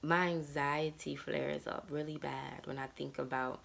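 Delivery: ordinary talk, nothing marked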